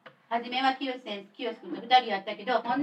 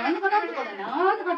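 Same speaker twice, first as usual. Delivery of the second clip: mock Fukui dialect; 6 semitones higher than usual. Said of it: "福井弁を喋る人だった".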